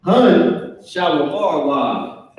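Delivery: "Hun, Shawamar" with a slow drawn-out "Shawamar"; Hebrew phrase demo. Shown as a demonstration. Teacher slowly modelling "Hun, Shawamar" for the class. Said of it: "this is what they would say".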